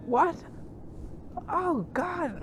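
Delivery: high, strained disbelief; shocked reaction. Retold or imitated. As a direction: react like you can't believe it. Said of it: "He's like".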